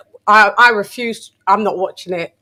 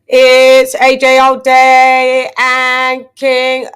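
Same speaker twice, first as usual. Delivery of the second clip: hype announcer; 3 semitones higher, 9 dB louder, much slower than usual. Acted. Announcing guests onto the show.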